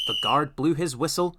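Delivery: plain reading voice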